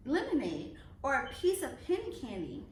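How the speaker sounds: ordinary delivery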